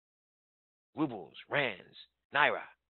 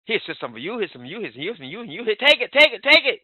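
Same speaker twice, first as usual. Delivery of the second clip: building from eager to a full shout; bursting, contagious energy thrust at the room; Jillian Parker's energy; 6 semitones higher, 13 dB louder, faster than usual. Retold or imitated. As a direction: hand out excitement, louder each time until you yell. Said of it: "That's Jillian Parker. That's the real Jillian Parker".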